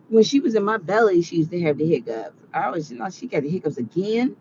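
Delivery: ordinary talk, nothing marked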